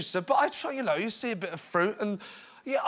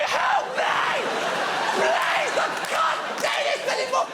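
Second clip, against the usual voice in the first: very high, shrieking cry for help; hammed-up panicked shriek; panicked hoover; slower than usual. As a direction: scream for rescue like a drugged-up hoover. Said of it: "He's actually off the ground going".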